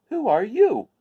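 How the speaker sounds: high curious scarecrow. Acted. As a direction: ask brightly like a curious straw man. Scarecrow voiced.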